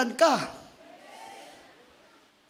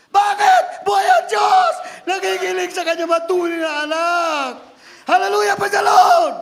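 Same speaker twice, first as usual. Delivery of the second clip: loud, much higher exultant shout; fiery preaching; 5 semitones higher, 7 dB louder than usual. Acted. Preacher proclaiming God alive, exultant shout. Peak of sermon.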